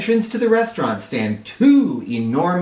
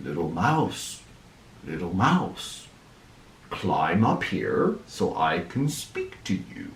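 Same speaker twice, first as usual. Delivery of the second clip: low, soft, hushed dragon voice; low whispering dragon calling the mouse; 7 semitones lower, 7 dB quieter than usual. Acted. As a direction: call down softly like an old wooden dragon. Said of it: "whispered down to him".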